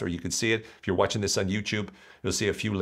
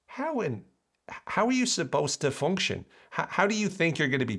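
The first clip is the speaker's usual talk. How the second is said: pitched-up incredulous question; exasperated disbelief; 10 semitones higher than usual. Real, not acted. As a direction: ask it in total disbelief. Ranting about negative media diet.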